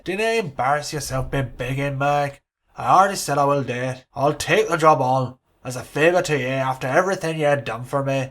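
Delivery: put-on loud, firm Scottish-Irish hybrid accent; a mock Sir Alex soothing his assistant in a hybrid accent. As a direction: settle him down in a booming Scottish-Irish accent. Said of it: "Sir Alex has got an Irish accent".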